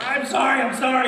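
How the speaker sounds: high, sheepish sorry; mock flustered actor saying sorry to the director; flustered apology. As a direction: apologize in a rush, a bit panicked. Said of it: "And I'm like".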